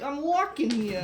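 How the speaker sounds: hammed-up East Coast accent, high and slow; mock East Coast accent. Shown as a demonstration. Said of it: "you gotta start doing".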